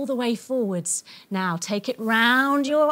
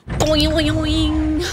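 cartoon boing; 2 semitones higher, 4 dB louder than usual. Crash landing bounce.

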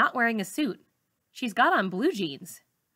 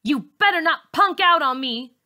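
a loud, higher, hard threat; mean threat; 6 semitones higher, 6 dB louder than usual. Acted. Bully menacing a scared classmate, loud and hard. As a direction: throw the words like bricks. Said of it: "Char throws mean words at me".

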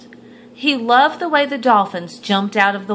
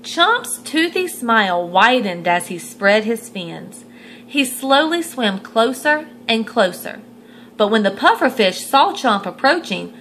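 ordinary delivery

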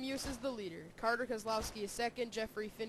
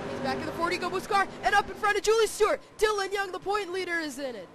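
excited; 8 semitones higher, 10 dB louder than usual. Real, not acted. Big wreck called live.

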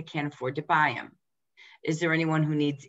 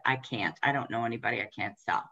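imitating a discouraged recruit, lower and softer; 6 semitones lower, 4 dB quieter, faster than usual. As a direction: let it sink, quieter and lower, like someone giving up. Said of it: "they automatically said".

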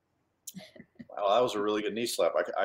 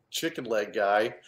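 ordinary talk

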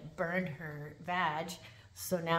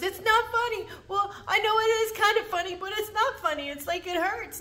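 much higher, louder whiny protest; whiny inmate; 10 dB louder than usual. Retold or imitated. Inmate protesting the laughing officer, high and whiny. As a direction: squeal it like a wounded complaint.

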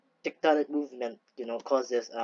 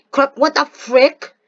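frustrated outburst; 10 semitones higher, 10 dB louder than usual. Real, not acted.

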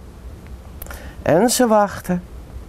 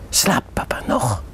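breathy hushed whisper so papa keeps sleeping; soft whisper; 5 semitones lower, 4 dB quieter than usual. A narrator's reading. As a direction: whisper it, barely voiced. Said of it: "vraagt ze zacht". (zacht fluisterend)

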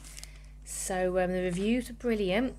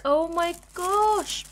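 slow, drawn-out, louder wonder; slow awed exclaim; 7 semitones higher, 5 dB louder, much slower than usual. Real, not acted. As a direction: stretch it out in amazement. Seeing the baby.

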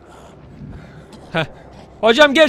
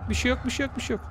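soothing; 8 dB quieter, faster than usual. Real, not acted. Calming a scared companion.

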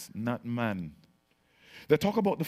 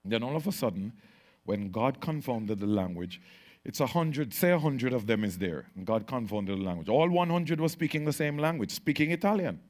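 usual speaking voice

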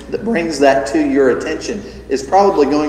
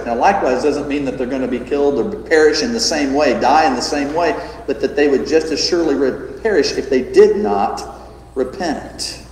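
ordinary delivery

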